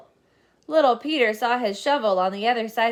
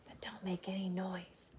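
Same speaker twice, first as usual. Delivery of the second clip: whisper; 2 semitones lower, 17 dB quieter than usual. Acted. Warning to sneak quietly.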